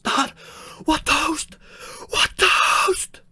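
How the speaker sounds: very high, loud crying wail; a son sobbing hysterically at the bedside; hammed-up kid bawling over his dad; wailing child. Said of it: "He's like".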